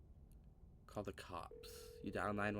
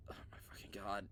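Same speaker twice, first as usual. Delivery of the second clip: whisper; 7 dB quieter, faster than usual. Real, not acted. Shocked muttering at the screen.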